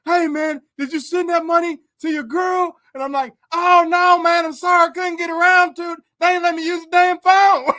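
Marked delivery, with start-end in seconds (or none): 0.1-2.8 yard inmate. Retold or imitated. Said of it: "They're like". 3.5-7.7 high, loud apologetic country voice; imitating Bullfrog. Imitating Bullfrog apologizing to the yard inmates, high and loud. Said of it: "acting like I'm Bullfrog".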